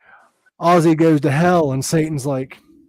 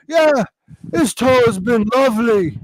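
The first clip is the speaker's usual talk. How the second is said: [name]; 6 semitones higher than usual. Retold or imitated. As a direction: blurt it out high and dazed, as if minutes behind. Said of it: "then Ozzy, Ozzy, two minutes later".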